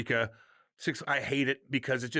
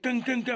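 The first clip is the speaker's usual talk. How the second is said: imitated ride chime; 11 semitones higher, 3 dB louder than usual. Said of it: "I'm like".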